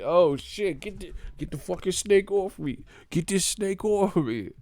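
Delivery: high, frantic mock panic about a snake. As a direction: play a guy shrieking to get a snake off him. Panicked victim of flying snake.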